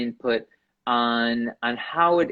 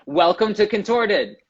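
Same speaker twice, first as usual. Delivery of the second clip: higher, louder upbeat welcome; host welcome; 6 semitones higher, 3 dB louder than usual. Acted. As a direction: open the show like a presenter. Host opening the episode.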